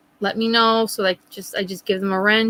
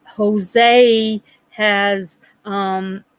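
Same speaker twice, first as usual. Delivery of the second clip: ordinary delivery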